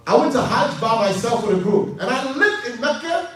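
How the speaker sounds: impassioned preaching at full force. Real, not acted. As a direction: proclaim it with rising fervour.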